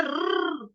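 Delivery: रर, a short rolled half र; half r demo. Shown as a demonstration. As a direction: roll a brief half र. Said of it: "कैसे बोल रही हूँ मैं?".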